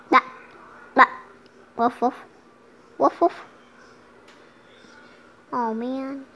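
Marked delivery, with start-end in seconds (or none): 0.1-3.3 mock barking. Kid pretending to be the dog, playful bark.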